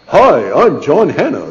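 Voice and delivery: an unfamiliar caller introducing himself on the phone, slow and pleasant; pleasant intoning voice. Retold or imitated. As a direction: intone it like a smooth stranger on the phone. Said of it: "the phone intoned, in an unfamiliar yet strangely pleasant voice".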